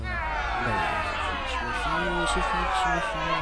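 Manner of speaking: loud, rising cries of victory; triumphant shout. Real, not acted. Winning the game, loud triumphant shouting. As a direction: shout it out as the match ends. Match won, shouting joy.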